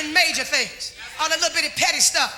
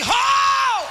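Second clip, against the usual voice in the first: high mock holler of delight; husband's holler; 8 semitones higher, 3 dB louder than usual. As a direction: let out a happy hollering "Ho!". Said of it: "'Cause I got him hollering like that".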